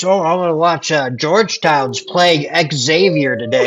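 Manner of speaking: lightly voiced clueless sports fan; mock a fan who says it wrong; mocking idiots. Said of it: "it's all those idiots".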